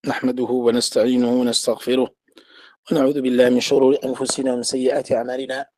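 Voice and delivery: rapid, low, even Arabic recitation; recited opening invocation. Real, not acted. Teacher opening his class with praise of God, rapid recitation. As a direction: run through the opening formula quickly on a level tone.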